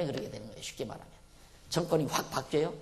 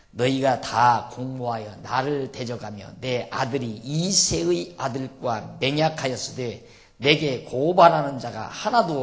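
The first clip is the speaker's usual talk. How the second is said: slow, loud pulpit reading of the text; scripture reading; 6 dB louder than usual. Acted. Preacher reading the Bible passage to the congregation, slow and loud. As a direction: proclaim the verses slowly and loudly.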